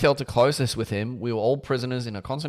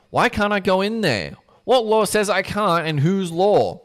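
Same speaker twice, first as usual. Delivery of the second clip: high, loud, mocking demand; O'Donnell challenges Rona's ruling, loud and mocking; 8 semitones higher, 5 dB louder than usual. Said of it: "his voice coming back, mocking".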